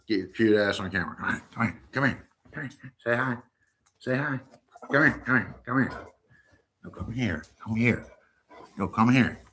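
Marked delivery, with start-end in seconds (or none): none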